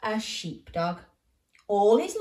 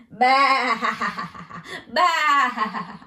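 loud bleating laugh; laughing sheep; 3 semitones higher, 7 dB louder than usual. Acted. The sheep mock Ben with loud laughing bleats. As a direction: bleat it out mockingly.

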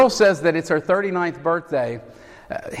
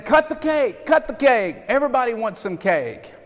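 much higher, eager chant of a pushy cook; pushy cook urging; 8 semitones higher, 3 dB quieter than usual. Retold or imitated. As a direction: hammer it like an impatient cook egging everyone on. Harry pushing for cake.

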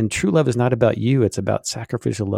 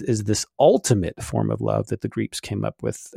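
usual speaking voice

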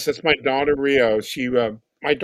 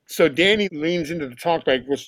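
usual speaking voice

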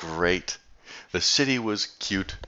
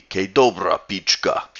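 "Ke dobra pichka" in a put-on Slovenian accent, a bit louder; hint of a Slovenian accent; Slovenian accent attempt; 4 dB louder than usual. Shown as a demonstration. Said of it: "how you say it with an accent".

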